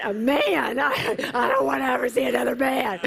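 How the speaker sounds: high, loud, rushed protest; incredulous retort. Retold or imitated. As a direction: toss it back in laughing disbelief. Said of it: "I said".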